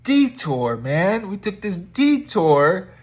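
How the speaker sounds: loud, drawn-out exclaim on detour; emphatic exclaim. Real, not acted. Telling viewers about the detour, loud and emphatic. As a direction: belt out the word detour like a complaint.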